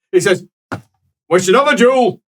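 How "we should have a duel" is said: put-on loud, raised barking old father; hammed-up grumpy priest voice; gruff angry old priest. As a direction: bellow like an outraged old cleric.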